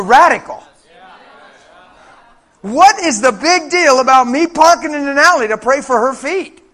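loud, incredulous challenge to the congregation; full-force preaching. Real, not acted. Preacher challenges congregation on what counts as radical, impassioned shouting. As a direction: punch out the key words, building to a high shout.

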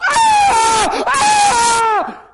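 very high pained scream. Retold or imitated. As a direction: cry out in pain. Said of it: "Like you can't even imagine".

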